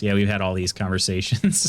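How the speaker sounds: ordinary talk, nothing marked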